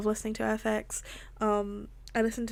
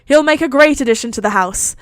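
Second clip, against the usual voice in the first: loud, raised, booming announcement; loud declaration; 3 semitones higher, 13 dB louder than usual. A narrator's reading. Thor welcoming the baby to the house, loud declaration. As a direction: declare it heartily to the room. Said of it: "Thor declared loudly".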